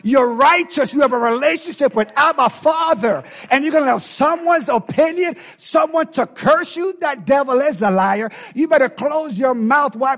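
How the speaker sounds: high, loud, shouting preacher; full-force preaching. Acted. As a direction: whoop it out to the congregation.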